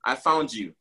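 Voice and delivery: low, tender divine voice; God to the searcher, low and tender. Acted. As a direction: speak as God gently claiming his child. God speaking to the lost.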